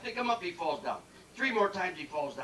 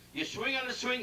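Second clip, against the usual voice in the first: usual speaking voice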